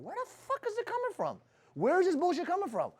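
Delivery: very high-pitched, incredulous questioning; acted bewilderment. Boss discovering negative bullshit in office, incredulous. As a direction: act baffled, voice shooting way up.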